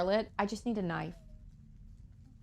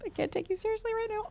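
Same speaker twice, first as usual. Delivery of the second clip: inner thought; faster than usual. Retold or imitated. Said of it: "but I'm like".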